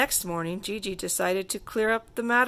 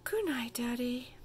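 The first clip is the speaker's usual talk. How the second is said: soft whispered goodnight; drowsy whisper; sleepy whisper; 4 semitones higher, 7 dB quieter than usual. A narrator's reading. Little girl saying goodnight to father, softly whispered.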